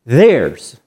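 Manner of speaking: "theirs" set apart and stretched, falling at the end; "theirs" drawn out with emphasis. Shown as a demonstration. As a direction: land firmly on "theirs" as one weighted word. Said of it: "he emphasized the word".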